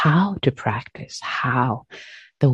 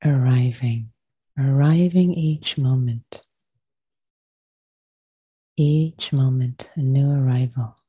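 unhurried meditation voice with long pauses; guided meditation. Acted. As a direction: gently guide them into stillness, leaving space after each phrase.

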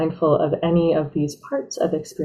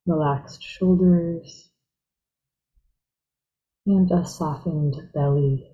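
guided meditation; 3 dB quieter than usual. Real, not acted. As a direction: ease them gently into stillness, unhurried.

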